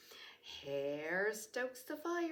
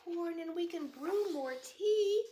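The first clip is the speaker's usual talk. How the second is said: high comforting mouse voice; squeaky mouse; 9 semitones higher, 4 dB louder than usual. Acted. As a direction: soothe the bear in a tiny squeak. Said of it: "Mouse squeaks".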